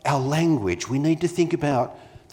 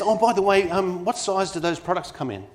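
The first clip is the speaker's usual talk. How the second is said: lightly voiced shopper, pitched up and breezy; casual customer question; 7 semitones higher than usual. Retail customer asking the assistant about sizes, lightly voiced and higher. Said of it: "she says".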